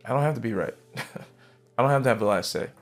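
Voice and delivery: normal talking voice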